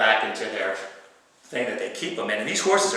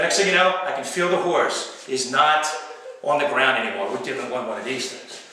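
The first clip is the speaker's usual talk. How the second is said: normal talking voice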